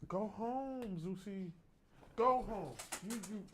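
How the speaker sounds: high, soft coaxing talk to a puppy; pet talk. Real, not acted. Cooing at the dog to leave.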